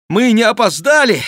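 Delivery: high, loud excited cry; exclaiming. A narrator's reading. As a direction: call it out high on arriving. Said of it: "воскликнул очень знакомый голос". (громкий взволнованный возглас)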